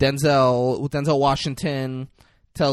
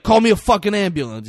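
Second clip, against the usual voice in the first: louder, higher, rapid acted demand; acted urgent demand; 7 semitones higher, 7 dB louder, faster than usual. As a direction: demand help fast, like an acted wounded cop. Wounded cop demands help.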